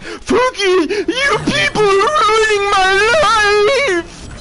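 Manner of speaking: a crybaby shriek at the world; mock outraged boomer. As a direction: ham up a sobbing furious grandpa. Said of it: "the man is on Facebook".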